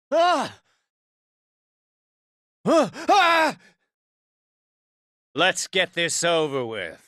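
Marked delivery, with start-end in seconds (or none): 0.1-3.6 sharp high attack cries; short bursts of effort in a fight; combat grunts. Acted.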